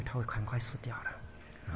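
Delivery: slow, hushed whisper of a hidden wish; whispered aside of a hidden curse; secret whisper. Retold or imitated. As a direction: let the hidden thought slip out under your breath. Inner wish behind polite face.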